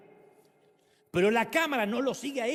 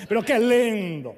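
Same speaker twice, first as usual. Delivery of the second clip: barge into the wake beaming; cheerful pastor; 9 dB louder, slower than usual. Retold or imitated. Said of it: "siempre entraba y decía".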